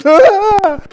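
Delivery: high, loud exasperated "Ah!"; mock despairing cry. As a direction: cry out as if the song finally broke you. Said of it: "I'm like".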